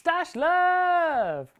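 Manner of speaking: high, drawn-out singsong shout; playful loud call. Pretend. Host announcing the stash segment, in a high singsong shout. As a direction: belt out the segment title.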